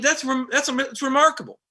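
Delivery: real astonishment; amazed. Real, not acted. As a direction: stress remarkable in wonder. Emphatic amazement.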